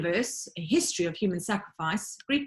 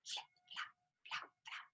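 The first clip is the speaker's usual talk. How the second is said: breathy unvoiced flap sounds, very soft; whispered flapping; 22 dB quieter than usual. A narrator's reading. Narrator imitating a flag flapping in the wind, breathy and faint.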